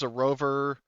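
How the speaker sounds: normal talking voice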